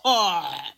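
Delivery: low imitated burp; loud burp. A narrator's reading. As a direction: mimic a goat's big belch. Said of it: "and burped loudly".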